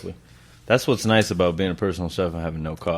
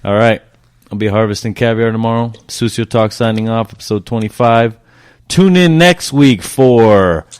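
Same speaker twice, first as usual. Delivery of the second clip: podcast outro; 3 semitones higher, 10 dB louder than usual. Acted. Podcast sign-off announcement.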